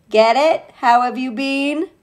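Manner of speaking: usual speaking voice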